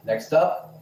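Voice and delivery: ordinary delivery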